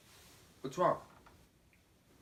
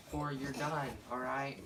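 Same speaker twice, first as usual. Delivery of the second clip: ordinary talk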